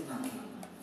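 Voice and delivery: very soft, low hushing voice; soothing reassurance. Acted. Iblis telling the weeping angels to relax, soft and soothing. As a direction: quietly settle them down.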